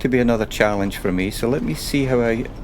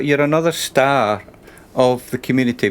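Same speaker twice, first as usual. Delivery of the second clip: ordinary delivery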